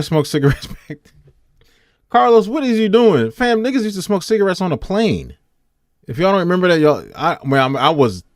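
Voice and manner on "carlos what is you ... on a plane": incredulous scolding. Real, not acted. Mock scolding of co-host over smoking.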